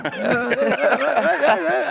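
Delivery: high burst of laughter. Real, not acted. Comic after his Boston Garden joke, high laughing. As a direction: crack up at your own joke.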